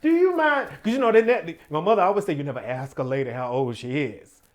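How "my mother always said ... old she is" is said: normal talking voice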